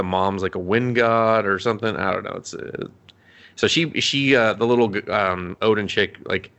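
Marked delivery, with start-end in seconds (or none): none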